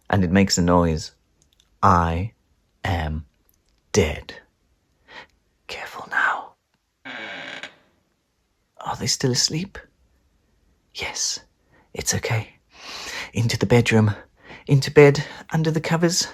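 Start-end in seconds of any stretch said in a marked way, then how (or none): 5.7-12.5 tense breathy whisper. Acted. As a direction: whisper past the sleepers. Sneaking past sleeping parents.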